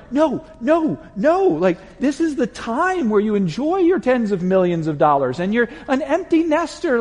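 high, loud self-argument; agitated. Retold or imitated. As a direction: protest to yourself, worked up. Arguing with himself, agitated.

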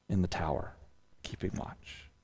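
soft, then whispered; fading to whisper. Acted. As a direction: let it die to a whisper. Dramatic close of image.

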